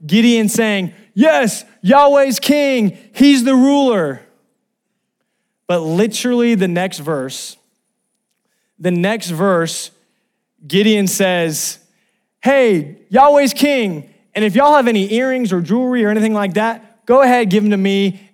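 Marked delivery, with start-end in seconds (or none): 1.1-4.2 high, loud victory shout; triumphant proclamation. Retold or imitated. Gideon ending the story on a high note, triumphant shout. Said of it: "end this story on this high note". 12.4-18.3 high, loud announcement; calling to a crowd. Retold or imitated. Gideon addressing the people, loud rallying call.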